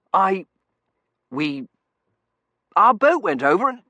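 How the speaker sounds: broken starts, loud and high; stammering. A narrator's reading. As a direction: trip over the first words. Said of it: "he stammered".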